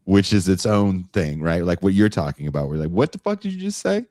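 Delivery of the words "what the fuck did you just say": high-pitched incredulous question; exaggerated disbelief; mock bafflement. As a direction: ask it high, as if you understood nothing. Acted baffled reaction to an accent.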